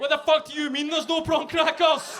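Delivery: high, loud comic rant; mock outrage. Customer to takeaway delivery, pretend furious shouting. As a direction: explode in hammed-up indignation. Imagined rage at missing prawn crackers.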